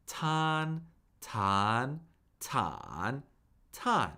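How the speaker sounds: cycle "tan" through flat, up, down-up, down; "tan" four times, each with the next Mandarin tone; four-tone drill. Shown as a demonstration. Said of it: "cycle this syllable through the four".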